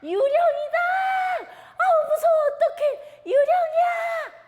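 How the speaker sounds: scream, it's a ghost; screaming in fright. Acted. Disciples seeing a figure on the water screaming in terror. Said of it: "하고 소리를 질렀어요".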